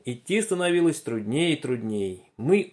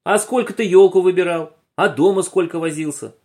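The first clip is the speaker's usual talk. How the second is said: louder, higher accusing questions; reproachful scolding; 5 semitones higher, 6 dB louder than usual. Acted. Boy blames his friend for getting lost, loud and reproachful. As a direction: throw the blame back at him. Quarrel over who got them lost.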